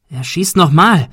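loud, urgent warning cry; alarmed shout at a gunman. Acted. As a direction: yell it to warn the group.

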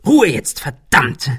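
laut und viel höher, wütend gefaucht. A narrator's reading. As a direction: fahr sie wütend an. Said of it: "fauchte Ben". (wütend fauchend)

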